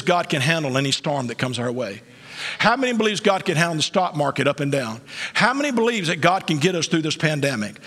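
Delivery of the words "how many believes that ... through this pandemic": usual speaking voice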